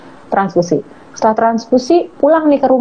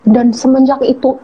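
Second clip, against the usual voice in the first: usual speaking voice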